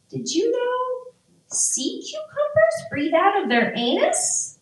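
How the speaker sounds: put-on high-pitched little kid voice; three-year-old. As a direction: pipe up like an eager small child. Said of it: "like, a three-year-old".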